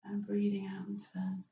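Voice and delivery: breathy whispered cue; soft yoga whisper. Real, not acted. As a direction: let the words fall to a whisper.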